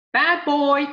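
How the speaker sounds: high, loud squawking bird voice; parakeet voice. Acted. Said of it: "the bird repeated again".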